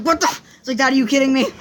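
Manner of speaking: high, loud disbelief; a startled kid protesting; shocked outburst. Retold or imitated. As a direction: squeak it out in panic at dad. Kid's shocked reaction retold.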